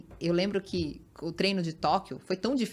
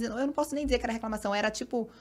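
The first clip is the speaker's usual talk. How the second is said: normal talking voice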